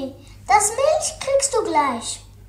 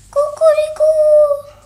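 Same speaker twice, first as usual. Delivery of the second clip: loud, high, slow cuckoo-clock call; 10 semitones higher, 12 dB louder, slower than usual. Pretend. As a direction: ring out like a cuckoo clock alarm. Wecker ruft Kuckuck. (lauter, hoher Kuckucksuhr-Ruf)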